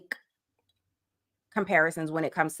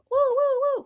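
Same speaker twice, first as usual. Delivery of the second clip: high-pitched "woo, woo" siren imitation; playful police-wagon wail; mock siren. Joking about calling the paddy wagon, mock siren.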